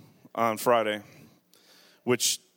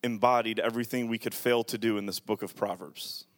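prayer; 3 semitones lower, 4 dB quieter than usual. Acted. Pastor praying aloud.

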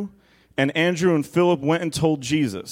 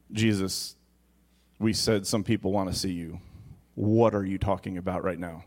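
mock aside; 7 semitones lower, 7 dB quieter than usual. Mock complaint to Jesus.

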